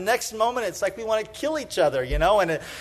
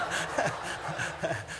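breathy silent laugh; 3 semitones lower, 10 dB quieter than usual. Real, not acted. Laughing at own memory.

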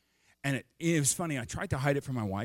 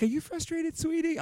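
sweet wife. Retold or imitated. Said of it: "She's like".

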